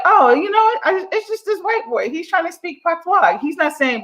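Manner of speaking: a put-on voice for easygoing islanders; dismissive Jamaican shrug. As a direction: brush it off like it's nothing.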